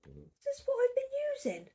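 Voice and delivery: gasping, high and loud; sudden realisation with a gasp; surprised gasp. Real, not acted. Discovers wrong hook size.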